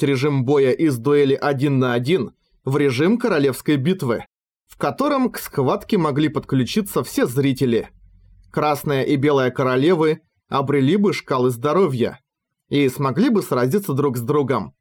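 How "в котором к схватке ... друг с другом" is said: usual speaking voice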